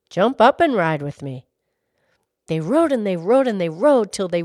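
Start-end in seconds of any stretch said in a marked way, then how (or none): 0.1-1.5 low, louder horse voice. A narrator's reading. Horse inviting the girl to ride, low and hearty.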